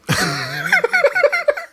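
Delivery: high-pitched cackling laugh; laughing fit. Real, not acted. Reactor cracking up at the ants clip, high helpless laugh.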